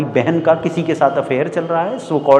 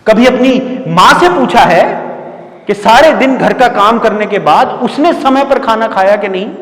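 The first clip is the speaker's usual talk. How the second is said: loud, higher-pitched challenge; angry reproach; 6 semitones higher, 6 dB louder than usual. Acted. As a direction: confront them with raised voice. Teacher reproaches class about their parents. (ऊँची आवाज़ में उलाहना भरा सवाल)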